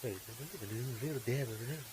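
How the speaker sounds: soft calm painter voice; gentle, soothing TV painter; Bob Ross. Retold or imitated. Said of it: "I miss Bob Ross".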